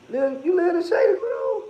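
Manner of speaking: loud, high-pitched shouted question; calling out. Real, not acted. Asking someone if they live in Shady Grove, calling out. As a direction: call it out, pitched right up.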